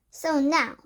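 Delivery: bark. A narrator's reading. Grand High Witch barking at her audience. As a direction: bark it at the crowd. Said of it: "barked the Grand High Witch".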